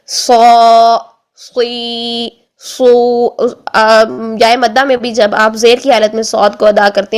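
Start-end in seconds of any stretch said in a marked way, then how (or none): 0.1-3.3 long-held سو، سوئی، سو, one at a time; lengthened heavy saad. Shown as a demonstration. Teacher showing saad with madd, سو stretched out. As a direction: stretch the vowels of سو، سوئی، سو after a thick s. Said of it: "مد کی حالت میں کر لیجئے".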